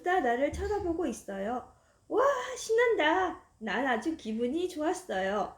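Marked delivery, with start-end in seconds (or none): 2.1-3.4 put-on high, delighted child cheer; child thrilled on a shiny new bike; excited. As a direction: squeal with joy.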